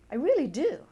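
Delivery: really mean it; emphatic affirmation. Pretend. Tapping script, affirming release.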